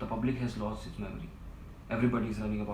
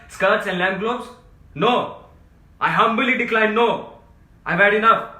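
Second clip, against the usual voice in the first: rising from firm to full shout; building to a shout; 6 semitones higher, 13 dB louder than usual. Acted. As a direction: work up into a desperate yell. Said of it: "Don't shout".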